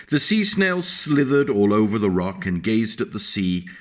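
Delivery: put-on lilting read-aloud voice for a child; sing-song picture-book reading; storybook narration. A narrator's reading. Narration beside figures.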